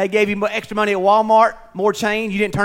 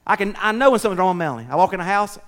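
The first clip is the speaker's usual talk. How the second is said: ordinary talk, nothing marked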